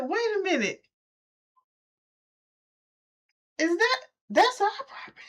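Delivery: high, stunned disbelief; can't believe your eyes; shocked disbelief. Retold or imitated. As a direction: owner arriving to find the job undone, high and shocked. Seeing the ruined property.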